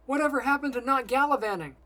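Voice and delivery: high, loud accusing question; angry challenge. A narrator's reading. Simon challenges his friend in flight, angry and loud.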